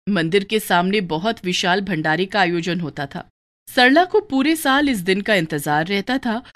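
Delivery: ordinary delivery, nothing marked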